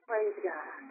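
usual speaking voice

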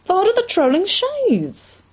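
high, drawn-out theatrical exclamation; exclaiming. A narrator's reading. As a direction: cry it out high and long, like a pub character. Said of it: "one of the men at the next table exclaiming".

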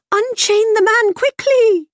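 whinny the order urgently; whinnying mare. A narrator's reading. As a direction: mare urging the boy to save the fish, whinnying. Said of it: "whinnied the mare".

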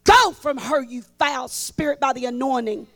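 loud rebuke of a spirit, imitated; mock commanding rebuke. Preacher retelling Norval casting out a foul spirit, loudly. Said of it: "He said".

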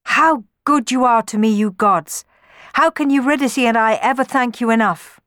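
loud, high, joyful thanks; happy. A narrator's reading. Orpheus to the gods after reunion, happy. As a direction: exclaim it with joyful gratitude. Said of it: "Orpheus said happily".

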